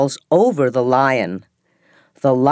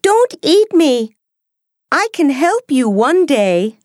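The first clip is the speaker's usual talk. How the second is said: very high, squeaky mouse voice; tiny frightened mouse begging; scared little mouse; 11 semitones higher than usual. Acted. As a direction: pipe it up high like a timid mouse. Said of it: "The mouse is scared".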